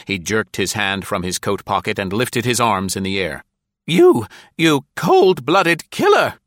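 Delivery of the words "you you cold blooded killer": high, loud, slow gasping outcry; gasped accusation. A narrator's reading. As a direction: gasp it out in horror, much higher. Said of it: "Nora Beamish gasped".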